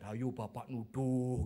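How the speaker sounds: slow, drawn-out mock chant; teasing schoolkids. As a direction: imitate pupils taunting their teacher. Class teases the accusing teacher.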